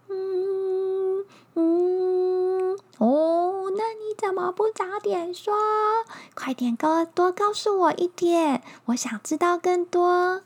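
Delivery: high, eager bug voice; eager little bug. Acted.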